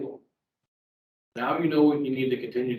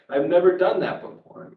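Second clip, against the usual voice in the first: mocking closed mindset; 6 semitones higher, 5 dB louder than usual. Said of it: "that's their mindset".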